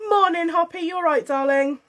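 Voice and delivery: ordinary talk, nothing marked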